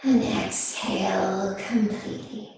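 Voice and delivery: slow, unhurried yoga teacher voice with long pauses; guided meditation. Real, not acted. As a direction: ease them gently into stillness, phrase by phrase. Leads seated breathing meditation.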